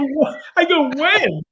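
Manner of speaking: high, loud startled questions; retold alarm. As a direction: act out a stunned patient. Retold shock at doctor's news.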